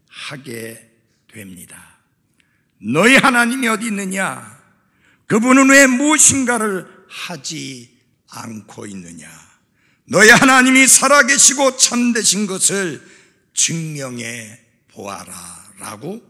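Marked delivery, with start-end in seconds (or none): 2.8-9.3 loud, raised challenge that drops at the end; preached taunt in measured phrases; mocking challenge. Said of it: "그렇게 비웃고 조롱하고". 10.0-15.7 loud, high preached taunt, falling and slowing at the end; imitated jeering challenge; mocking demand. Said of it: "그렇게 비웃고 조롱하고".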